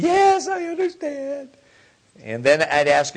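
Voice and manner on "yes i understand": put-on high, slow little child's voice; meek child answering dad, high and slow. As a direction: play the small child answering meekly. Child answering dad before spanking.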